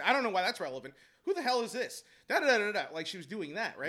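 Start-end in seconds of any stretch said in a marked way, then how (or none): 0.0-2.8 louder, higher mock interrupting; imitating talking over someone. Head coach talking over the principal, mocking imitation. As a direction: mimic her barging over the other person loudly. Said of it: "she would talk over and say".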